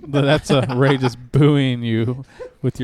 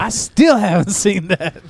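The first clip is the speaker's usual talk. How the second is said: laughing mock friend voice; hammed-up whiny quote; imitating Ray; 5 semitones higher, 4 dB louder, slower than usual. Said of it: "half of it would be like".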